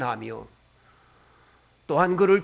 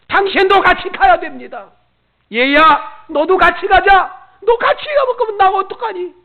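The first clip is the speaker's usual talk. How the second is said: loud, much higher begging; urgent pleading; 11 semitones higher, 12 dB louder than usual. Pretend. Believer pleading with spouse and child to come to heaven, loud. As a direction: beg them to come along. Imagined pleas to family.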